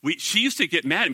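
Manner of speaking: ordinary talk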